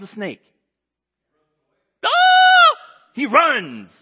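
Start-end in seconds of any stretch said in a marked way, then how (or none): 2.0-2.7 much higher, loud shriek of fright; a sudden terrified yelp at the snake; startled scream. Acted. Moses sees the snake. 3.1-3.9 louder, higher burst; excited. Acted. Fleeing from the snake.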